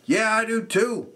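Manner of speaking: high, goofy agreement; mock agreeing fan. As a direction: play along in a silly voice. Answering the beer line.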